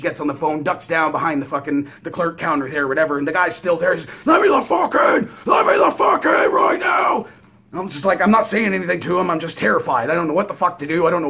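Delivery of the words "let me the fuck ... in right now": very high, loud, strained yell; enraged gunman screaming. Retold or imitated. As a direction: scream a demand through a shut door. Gunman screaming through the door.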